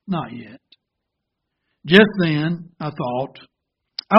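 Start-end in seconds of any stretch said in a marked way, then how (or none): 0.1-0.6 soft, low, calm reply; kindly. Acted. Potter watching through the glass, answering the teacup kindly and softly. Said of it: "I saw him kindly say".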